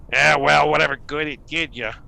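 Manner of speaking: loud, high, scoffing call from upstairs; voice from above. Acted. Gnome calling down to the priestess, loud and scoffing. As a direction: toss a sneer down from the roof. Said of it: "a voice from above say".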